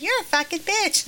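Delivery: put-on tiny, high little-boy voice; Roscoe's tiny voice. Little boy repeating the insult in the kitchen, tiny high voice. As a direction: repeat the insult in a small child's high pitch. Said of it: "this is in Roscoe's tiny voice".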